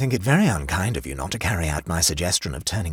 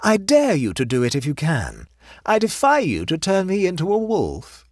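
raised, high-pitched dare; provocative; 10 semitones higher, 4 dB louder than usual. A narrator's reading. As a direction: throw down a teasing challenge. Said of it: "laughed Mary provocatively".

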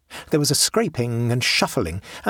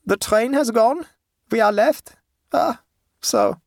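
put-on high German-accented peasant woman; unhurried high voice, German accent; German dialect; 11 semitones higher, 4 dB louder than usual. A narrator's reading. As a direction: play a German-speaking countrywoman, pitched high. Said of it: "said the woman at last in German dialect".

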